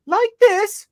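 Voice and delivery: very high loud piglet squeak; Peppa Pig voice. Retold or imitated. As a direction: squeal it like a proud cartoon pig. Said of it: "horrible impersonation of the episode".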